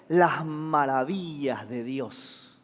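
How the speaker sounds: low, soft and slow reverent line; hushed awe. Acted. Preacher quotes what the crowd heard, hushed awe. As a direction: savour it slowly, very softly.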